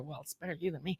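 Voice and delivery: soft, dry throwaway; wry aside. Retold or imitated. Teasing a worried friend, soft and wry. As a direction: toss it off quietly, teasing. Joking reply retold.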